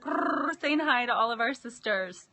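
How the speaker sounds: usual speaking voice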